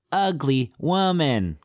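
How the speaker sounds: high, slow talking gorilla voice; drawn-out jealous insult from an ape; Amy. Acted. Jealous gorilla insulting the woman, high slow gorilla voice. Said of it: "The gorilla, she gets jealous".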